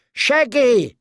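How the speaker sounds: put-on high, delighted child's call; happy shout. A narrator's reading. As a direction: call out joyfully, pitch leaping up. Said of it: "gave a happy shout of".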